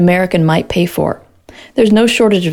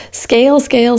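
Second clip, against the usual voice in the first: scale, scale tossed off high and mocking; sing-song mockery of the buzzword scale, scale; 5 semitones higher than usual. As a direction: poke fun at the VC mantra. Gretel mocks VCs' obsession.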